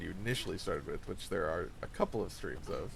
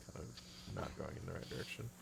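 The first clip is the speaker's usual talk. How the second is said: unvoiced aside to self; whisper; 3 semitones lower, 10 dB quieter than usual. Real, not acted. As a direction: whisper it while playing. Gaming, muttering to self.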